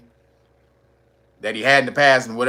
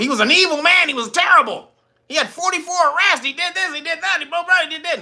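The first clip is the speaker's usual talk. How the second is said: mock outrage; 13 semitones higher than usual. Imitating the media railing against him, mock outraged. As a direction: rattle off accusations in a high mocking voice. Mocking the media's list of his sins.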